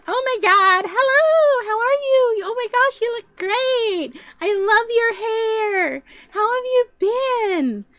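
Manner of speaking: over-the-top delighted greeting, stretched words; gushing greeting. Retold or imitated. Acting a gushing reunion greeting.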